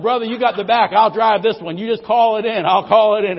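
loud, eager mock insistence. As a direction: grab the wheel, loudly. Eagerly claiming driver seat.

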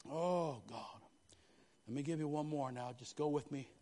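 ordinary talk, nothing marked

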